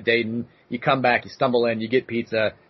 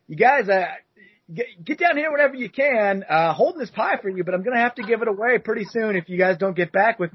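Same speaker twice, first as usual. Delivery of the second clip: raised pitch, a little less bright, pressing; Domino's guy, more insistent; 7 semitones higher than usual. Retold or imitated. Delivery guy leaving a third voicemail, pushier. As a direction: keep the eager voice but press a little harder. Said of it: "I'll do my best here to recreate".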